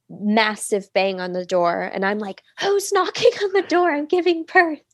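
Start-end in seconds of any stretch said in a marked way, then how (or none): 2.6-4.8 indignant mock cry. Labouring woman to whoever is knocking, high indignant mock cry. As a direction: cry out high in comic outrage.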